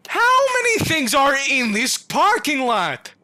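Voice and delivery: loud, high, slow outburst; exasperated shout. Real, not acted. Player overwhelmed by monsters, shouting. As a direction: yell it in disbelief at the crowd of monsters.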